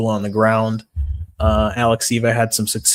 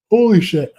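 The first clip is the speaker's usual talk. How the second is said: talking through a big yawn; high, loud yawn on the words; 9 semitones higher, 6 dB louder than usual. Real, not acted.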